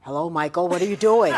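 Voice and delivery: low put-on phone voice; disguised secretary voice. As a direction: do an exaggerated deep caller voice. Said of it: "Oh my God, that voice is incredible".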